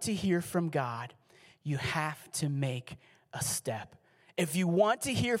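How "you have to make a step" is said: whispered key point. Real, not acted. Pastor's key point dropped low.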